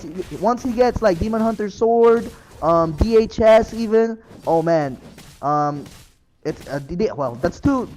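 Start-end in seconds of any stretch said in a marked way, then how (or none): 6.4-7.9 stuttering. Real, not acted. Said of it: "stuttering myself".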